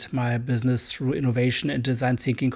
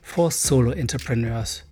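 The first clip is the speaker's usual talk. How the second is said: upbeat trailer voice, a bit louder over the music; promo read; 5 dB louder than usual. Acted. Host pitching his new podcast to listeners, bright promo read. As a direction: sell the show like a radio jingle.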